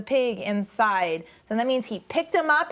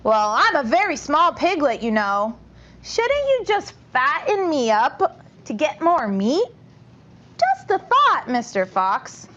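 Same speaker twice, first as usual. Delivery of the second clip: higher, louder small piglet; 3 semitones higher, 3 dB louder than usual. Acted. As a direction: plead cleverly as a little piglet. Piglet voiced in story.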